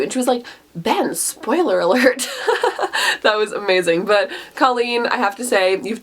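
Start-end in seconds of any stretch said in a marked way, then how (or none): 0.8-2.3 slow, laughing quote; amused teasing laugh. Retold or imitated. Quoting Fallon, laughing. 2.3-3.2 high breathy laugh, falling; real airy giggle. Real, not acted. Laughing after quote.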